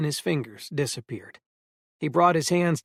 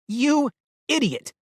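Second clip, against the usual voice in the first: high furious yell at full volume; shouted; 10 semitones higher, 4 dB louder than usual. A narrator's reading. Eleanor yelling at Grady for going off alone, furious shout. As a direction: scream it at him, slowly. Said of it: "Eleanor shouted".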